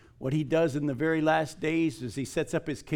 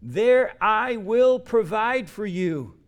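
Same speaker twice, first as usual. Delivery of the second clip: very slow, louder and higher, "will" leaned on; emphatic; 7 semitones higher, 6 dB louder, much slower than usual. Shown as a demonstration. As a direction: pound out the promise word by word. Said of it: "Look at what he says".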